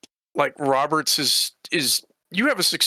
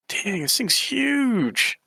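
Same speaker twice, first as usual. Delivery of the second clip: awed; 6 semitones higher, much slower than usual. Acted. Awed at the hall.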